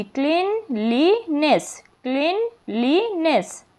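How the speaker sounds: "ক্লিনলিনেস" very slowly in three parts, "ক্লিন", "লি", "নেস"; split into syllables. Shown as a demonstration. Teacher showing three syllables, "ক্লিনলিনেস" slowly broken as "ক্লিন", "লি", "নেস". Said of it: "তিনটি syllable যুক্ত word".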